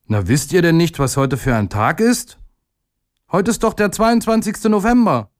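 high, eager schoolboy voice; proud excitement. A narrator's reading. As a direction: tell your mates the obvious big news. Boy boasts to classmates.

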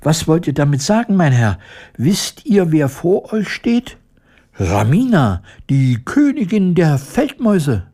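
hohe, schnelle, empörte Mäusestimme; tiny critter. A narrator's reading. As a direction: piepsend und stolz auftrumpfen. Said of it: "piepste das Tierchen empört". (empörte Mäusekönigin, viel höher)

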